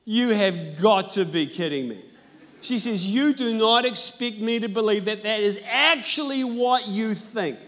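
loud disbelieving retort; a touch of outraged disbelief; incredulous. Retold or imitated. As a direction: scoff at the news. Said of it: "She said".

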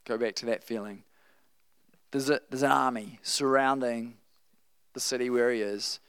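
ordinary delivery

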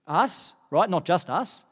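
ordinary talk

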